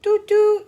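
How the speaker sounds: high, loud two-note toot; school bus honk. A narrator's reading. Storyteller sounds the school bus for listeners, honking high and loud. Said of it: "hizo el autobús de la escuela".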